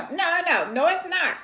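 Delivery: ordinary talk, nothing marked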